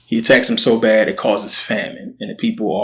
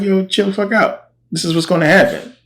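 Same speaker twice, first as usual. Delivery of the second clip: pitched way up, a playful retold brush-off; mock exasperated Elisha; 10 semitones higher than usual. Elisha tells the upset king to calm down, high mock exasperation. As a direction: tell him to chill, high and teasing.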